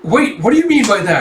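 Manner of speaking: high, loud demand to explain; acted affronted outburst; indignant question. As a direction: challenge a parting remark, taken aback. Lord reacts to insult.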